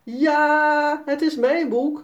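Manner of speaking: put-on excited girl calling out; called out. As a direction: call out in triumph. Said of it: "roept Sanne".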